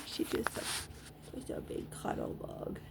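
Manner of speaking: soft, hushed murmur of affection; whispered fondness. Real, not acted. Owner murmuring to her dog, whispered.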